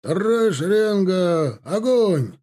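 high, drawn-out military command; barked firing command. A narrator's reading. Captain ordering a volley, barked command. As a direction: call the volley to the whole rank. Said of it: "рявкнул капитан Бегов".

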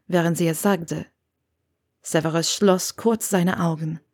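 ordinary delivery, nothing marked

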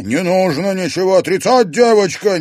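high, loud nervous shout. Acted. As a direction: shout it out, shaking. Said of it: "нервно крикнул Пивоваров".

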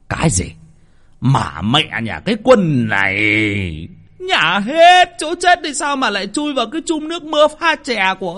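high, shouted furious cursing; furious yelling. A narrator's reading. Old master shouting curses at the servants over the body. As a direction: bellow curses at the servants in rage. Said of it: "cụ sôi tiết quát".

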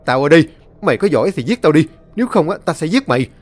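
put-on young man screams a challenge at the demon; furious challenge. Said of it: "hét lớn".

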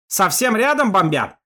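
loud shout, stress on рядом. Acted. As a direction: yell over the engine noise. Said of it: "перекрикивая завывание мотора".

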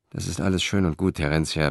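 ordinary delivery

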